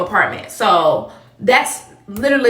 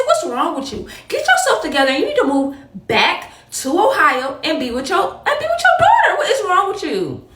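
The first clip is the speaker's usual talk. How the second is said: much higher, laughing exasperated rant; mock outraged scolding; 8 semitones higher than usual. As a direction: scold your mother half laughing. Cussing out mother, laughing.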